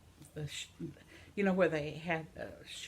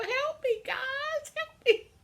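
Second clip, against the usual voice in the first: squeaky high mock plea for help; very high, loud pretend cry for help; 7 dB louder, slower than usual. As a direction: whine it like a helpless kid.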